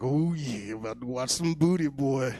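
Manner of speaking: drawn-out, relishing put-on voice; mock lecherous. Teen settles in to watch the channel, mock lecherous. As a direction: savour it like an eager teen, hammed-up.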